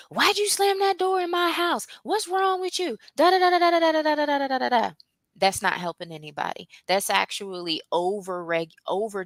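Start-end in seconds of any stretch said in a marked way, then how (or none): 0.1-4.9 much higher, nagging parent ranting; hammed-up scolding that rises then fades into da da; mock angry scolding. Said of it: "what we tend to do is".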